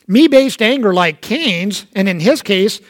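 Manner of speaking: usual speaking voice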